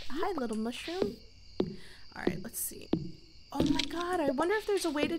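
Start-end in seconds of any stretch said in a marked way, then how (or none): 1.6-2.9 whisper. Real, not acted. Hushed aside.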